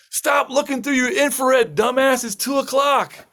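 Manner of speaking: high, strained mock yell at moderate volume; retold yelling. Ground crew arguing back at the helicopter, mock yelling. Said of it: "the guys from the ground are yelling".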